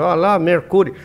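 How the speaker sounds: Mercury said loud and Americanized, MER-kyoo-ree; a mock receptionist saying Mercury the American way; imitating hotel staff. Guest imitating a hotel clerk answering the phone, Americanized. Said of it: "eles falam Mercury".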